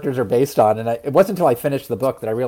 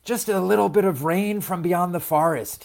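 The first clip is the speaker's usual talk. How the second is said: lightly voiced, higher-pitched seed; young seed character; 6 semitones higher, 4 dB quieter than usual. A narrator's reading. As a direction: make light of the rain, pitched a little up.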